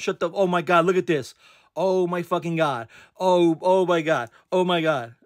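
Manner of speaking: high-pitched, stunned excitement; freaking out. Real, not acted. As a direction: freak out in real, breathless disbelief. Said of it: "I'm freaking out right now".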